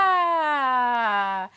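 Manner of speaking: drawn-out falling "ah"; long loud sigh. Real, not acted. Recovering from laughing at the bit, long sigh. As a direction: let out a long, voiced sigh. Winding down after laughing fit.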